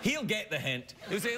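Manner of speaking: ordinary talk, nothing marked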